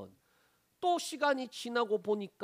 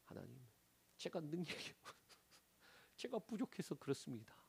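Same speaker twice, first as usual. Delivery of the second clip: self-blaming prayer; 5 semitones lower, 12 dB quieter than usual. Retold or imitated. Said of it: "자기 자신을 책망해요".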